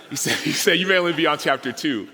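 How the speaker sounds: repeating his line through a laugh. Real, not acted.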